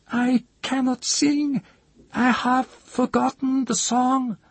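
very high, slow, frightened chanter; terrified high voice. A narrator's reading. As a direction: cry it out in terror, high. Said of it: "his voice rose high as if in terror".

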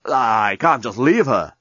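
slow, understated yell; a drawn-out protest, mildly called back. A narrator's reading. Dan refusing to leave Nettie, mildly yelling back. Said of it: "Dan yelled back".

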